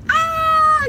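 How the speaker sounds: imitated soprano shriek, held high; high-pitched child's scream. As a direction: mimic a daughter's piercing high note. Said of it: "this high-pitched voice when she screams".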